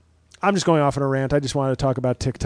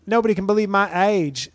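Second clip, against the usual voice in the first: higher, bragging influencer voice; TikTok woman voice; 6 semitones higher than usual. Retold or imitated. Comedian quotes a TikTok mom in a higher bragging voice. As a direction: show off your youth like a smug poster. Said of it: "my TikTok woman voice".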